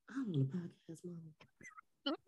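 faint voice from another room; off-mic voice. Real, not acted. Mama calling from another room, far off and faint. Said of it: "Sorry, y'all, that was my mama".

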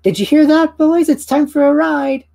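high, loud, gleeful little creature; excited brownie. Acted. Brownie calling to the boys, high and gleeful. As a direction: cheer the other brownies on like a tiny ringleader.

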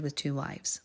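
hushed aside; whispering. Real, not acted. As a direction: drop to a whisper.

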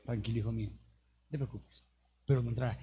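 low, soft fatherly reassurance; gentle God voice. Retold or imitated. God gently consoling Moses, soft and low. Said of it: "Después Dios le dijo".

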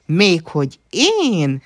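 high, loud, drawn-out amused retort; giggling. A narrator's reading. Lia answers Nana's teasing, giggling lightly. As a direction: toss it back, mildly tickled. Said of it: "kuccogott Lia".

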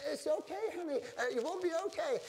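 very high little-lady voice; little old lady. Acted. Little lady tells the mother-to-be she'll be okay, imitated high voice. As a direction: imitate a lady cooing reassurance. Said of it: "And she goes".

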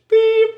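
high loud voiced beep; mock beep. Ending the fake greeting with a mock beep. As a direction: squeak out the beep. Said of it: "I said".

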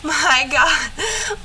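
high, choked-up exclamation; real tearing up. Real, not acted. Mother recalling her son crying, genuinely tearful. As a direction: let real tears catch in your voice. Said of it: "I'm literally tearing up thinking about it".

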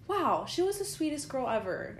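high-pitched wowed retelling; gushing. Retold or imitated. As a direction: imitate someone gushing after a customer. Acting out an overheard compliment.

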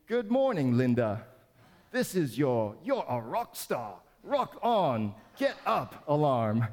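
mock British alarm voice, slowed at the end. Pastor mimicking the wake-up alarm for his wife, mock British accent. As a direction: butcher a smooth British accent on purpose. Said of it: "beautiful British voice".

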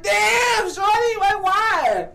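high-pitched, incredulous plea; mock wounded protest. Rejected man to Chicago girl, mock hurt protest. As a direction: whine it upward like a rebuffed suitor.

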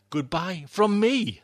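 ordinary delivery

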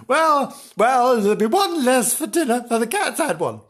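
high-pitched comic grumble; hammed-up domestic scolding; indignant wife. Wife goes on scolding husband.

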